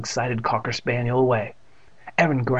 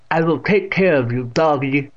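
put-on frowning old aunt; 3 semitones higher, 5 dB louder than usual. A narrator's reading. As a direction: tell the dog off loudly. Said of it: "frowning at Trigger".

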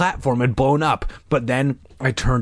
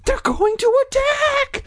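high, slow panicked yell; frightened yell. Acted. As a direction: stammer out a terrified yell. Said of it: "a boy yelled".

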